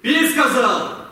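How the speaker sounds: the captain forces the lieutenant to drink, stern; stern order. Acted. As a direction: bark the order, no arguing.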